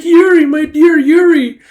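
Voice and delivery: high, loud, drawn-out mock wail; hammed-up melodramatic grief; anguished cry. Sister crying to her bullet-riddled brother, anguished cry.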